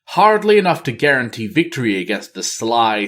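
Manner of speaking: plain reading voice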